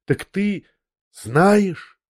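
high, rising gasp of a question; stricken question. A narrator's reading. Liza to the narrator, shocked she has found out, stricken question. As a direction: realize the worst as you ask.

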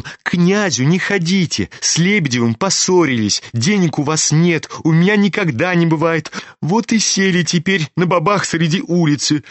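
put-on exasperated teenage son. A narrator's reading. Kolya scolds his father in the street, exasperated. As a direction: scold your father like an impatient lad.

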